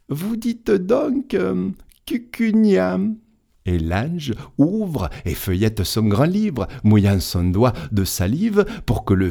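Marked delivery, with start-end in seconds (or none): 0.1-3.0 puzzled angel. Acted. Angel puzzling over the name.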